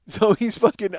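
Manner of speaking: ordinary talk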